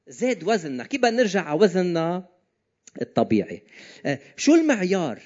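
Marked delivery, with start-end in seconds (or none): none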